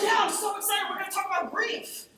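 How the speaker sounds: high, beaming "Yeah", cheerful about grief; bright cheer. Retold or imitated. Answering worried friends, high cheerful excitement. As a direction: play it oddly cheerful. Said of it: "And I'm like".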